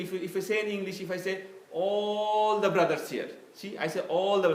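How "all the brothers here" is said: "All the brothers here" said slow and louder, "All" stretched; emphatic phrasing. Shown as a demonstration. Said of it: "so I have given an emphasis to you".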